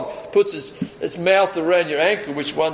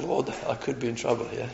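hushed prayer; 4 semitones lower, 8 dB quieter than usual. Retold or imitated. Desperate prayer facing a dog.

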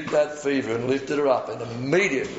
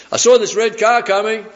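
higher, louder excited eyewitness; eager witness; 8 semitones higher, 7 dB louder than usual. Retold or imitated. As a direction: blurt it out like a keen witness. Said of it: "we all gave our testimony".

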